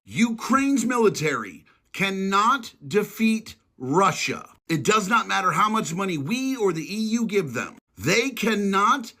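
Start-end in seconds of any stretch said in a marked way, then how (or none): 0.1-4.5 slow, spelled-out delivery with a falling end; slow and pointed, each word laid down; deliberately slow. Shown as a demonstration. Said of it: "I'm gonna say it real fucking slow". 8.0-9.1 very slow, heavy on every word; slow, emphatic restatement; deliberately slow. Shown as a demonstration. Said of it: "say it real fucking slow".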